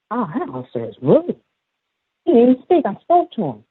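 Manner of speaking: lightly voiced indignant child, pitch climbing; indignant little boy. Little guy complaining about the officer, mildly indignant.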